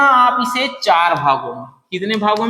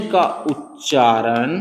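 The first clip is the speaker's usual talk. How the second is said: very slow, lower, long pauses; slow dictation; 7 semitones lower, much slower than usual. Shown as a demonstration. Teacher writing on the board, dictating very slowly. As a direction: draw out each phrase while writing. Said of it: "क्या मैं लिख सकता हूं कि अ के बिना".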